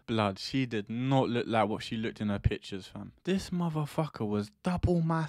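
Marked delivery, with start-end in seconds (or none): none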